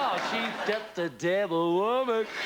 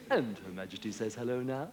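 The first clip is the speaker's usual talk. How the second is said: TV voiceover man; 4 semitones lower than usual. Retold or imitated. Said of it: "he sort of goes".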